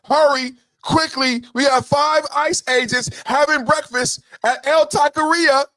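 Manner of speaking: high, hurried mock alarm voice; mock urgent alert. Mocking a frantic activist rallying the group chat.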